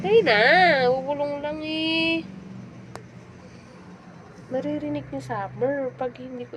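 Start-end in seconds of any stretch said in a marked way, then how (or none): 0.0-2.2 high start, long drawn-out ending; plead, dragging out the last word; whiny pleading. Real, not acted. Pleading with the reluctant listener.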